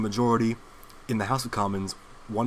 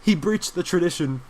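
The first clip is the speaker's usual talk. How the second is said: mock flustered stammer; slower than usual. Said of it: "they come out and they say".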